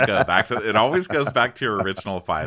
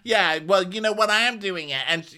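Jenny firing back at Mary, high and defiant; defiant mock shouting; 10 semitones higher, faster than usual. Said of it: "She goes".

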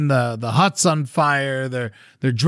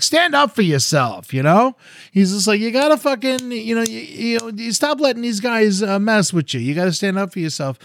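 higher-pitched, insistent elder's urging; urging pep talk; 8 semitones higher than usual. Retold or imitated. Village elder talks to a defeated man, urging. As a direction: push him to stand up, lightly acted.

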